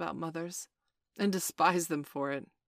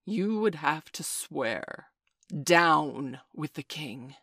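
slow, louder, through gritted teeth; 7 dB louder, much slower than usual. Acted. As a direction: grind each word out slowly. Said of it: "Through Hook's teeth came the answer".

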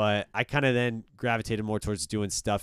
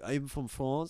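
higher-pitched fake French accent; 5 semitones higher, 4 dB quieter, slower than usual. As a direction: claim French roots in a put-on French accent. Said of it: "pretending to be French".

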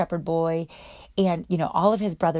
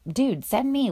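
eager plea; 6 semitones higher, slower than usual. Retold or imitated. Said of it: "So he's like".

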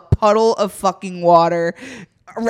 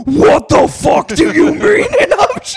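loud, higher demonic shriek; demon outburst; 7 semitones higher, 7 dB louder than usual. Retold or imitated. Guest told of an upcharge, demonic shout. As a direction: morph into a raging demon. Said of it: "morph into, like, a demon".

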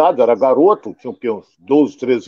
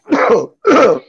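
sudden choking cough; coughing on a swallow; 9 semitones higher, 3 dB louder than usual. Real, not acted. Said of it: "Me engasguei aqui".

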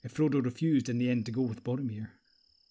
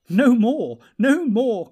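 much higher and louder, merry call; laughing cry; 11 semitones higher, 12 dB louder, much slower than usual. Acted. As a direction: cry out to stop the eager dwarf. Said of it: "cried the elves, laughing".

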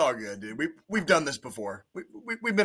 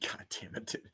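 hushed, unvoiced curse; whispering; 16 dB quieter than usual. Real, not acted. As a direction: whisper it under your breath. Whispered aside.

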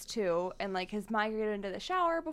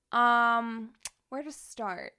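normal talking voice

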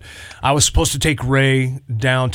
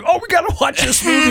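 excited friend; faster than usual. Retold or imitated. Friends excitedly suggesting a movie.

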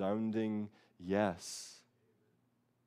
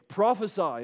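mocking taunt; 9 semitones higher, 8 dB louder than usual. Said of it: "they say".

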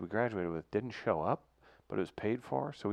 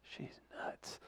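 very soft, whispered aside; confidential whisper; 17 dB quieter than usual. Retold or imitated. Coach privately to player about the teacher, whispering. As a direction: whisper it like a secret.

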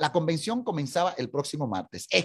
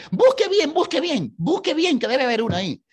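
much higher and louder repeated plea; insistent demand; 9 semitones higher, 6 dB louder than usual. Retold or imitated. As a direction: push the clerk to look again. Isaac insists on a slot.

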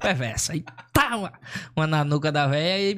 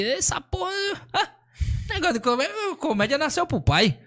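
much higher, swaggering self-praise; cocky boast; 11 semitones higher than usual. Retold or imitated. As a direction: crow that comedy was made for you. Boasting to himself after first show.

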